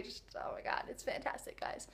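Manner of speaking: breathy excited whisper; hushed fan gushing. Real, not acted.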